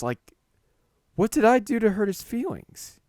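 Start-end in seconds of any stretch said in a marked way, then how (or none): 1.1-3.0 high-pitched bewildered question; louder, high, incredulous self-questioning; baffled protest. Acted. Speechless narrator wondering at the insult, baffled and high.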